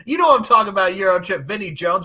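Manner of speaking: loud, high, breathless with laughter; laughing. Real, not acted. Host apologizing while still laughing, loud and high. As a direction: talk while still cracking up.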